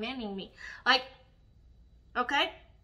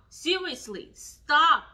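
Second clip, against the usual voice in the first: a sharp, much louder command; loud stop; 4 semitones higher, 6 dB louder, slower than usual. Real, not acted. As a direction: shout it at the brands. Demanding brands stop.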